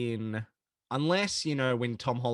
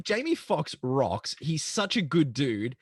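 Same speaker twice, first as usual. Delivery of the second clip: slow emphatic; slower than usual. Retold or imitated. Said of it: "'cause I'm like".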